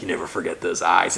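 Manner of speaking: a hint of a salty tow driver; gruff old fisherman. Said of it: "the old salty tow truck driver".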